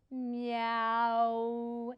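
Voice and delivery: long stretched meow; drawn-out cat meow. Shown as a demonstration. Teacher showing the proper cat sound, long meow. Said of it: "a little more like this".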